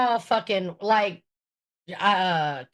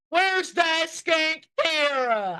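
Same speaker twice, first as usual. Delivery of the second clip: mocking voice; 6 semitones higher, much slower than usual. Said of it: "Steve's like".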